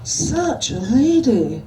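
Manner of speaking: slow, mock-prim Scottish sigh. A narrator's reading. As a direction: sigh it out like an offended lady. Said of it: "sighed Desiree".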